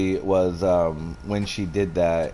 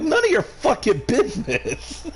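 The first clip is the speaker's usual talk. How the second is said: high snappy comeback. Retold or imitated. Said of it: "I'm like".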